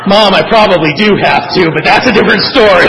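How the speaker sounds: loud, joking admission breaking into laughter; amused, laughing confession; laughing aside. Real, not acted. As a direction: toss it out loudly with a laugh.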